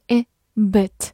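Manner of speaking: "bit" with a short, lax i; crisp short vowel in "bit". Shown as a demonstration. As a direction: say "bit" with a brief ih sound, not ee. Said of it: "字母I发短音A".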